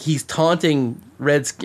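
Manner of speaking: normal talking voice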